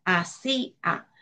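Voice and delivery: "hacía" slowly, in three separate syllables: a, sí, a; split into syllables. Shown as a demonstration. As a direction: break "hacía" into a... sí... a, with clear gaps. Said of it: "estaría dividida en tres sílabas".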